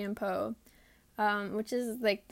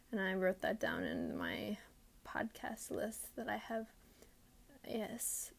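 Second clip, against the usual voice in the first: whispering; 9 dB quieter than usual. Real, not acted. Trailing aside.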